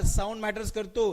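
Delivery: ordinary talk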